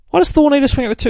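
high, fast, incredulous rant; exasperated outburst. Real, not acted. Reviewer baffled by Thor's two-handed swing, exasperated high outburst. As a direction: blurt it out in disbelief at the art. Speaker baffled by comic panel.

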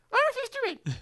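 chipmunk-high sped-up tape; fast-forward voice. Acted. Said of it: "Forward fast".

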